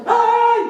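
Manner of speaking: high, loud startled "ay" yelp; sudden high shriek of alarm; woman's startled cry. Retold or imitated. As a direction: shriek like a lady who just dropped a plate. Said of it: "cómo dice".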